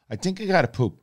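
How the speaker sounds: put-on high, small child's voice; dad imitating his toddler; little daughter voice. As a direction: squeak it out like a four-year-old. Four-year-old daughter quoted on toilet.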